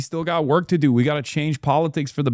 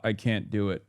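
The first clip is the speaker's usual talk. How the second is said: usual speaking voice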